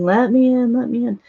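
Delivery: high mock plea, let me in; a lady at the gate begging to enter, high and imploring; pleading. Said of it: "the lady's pleading".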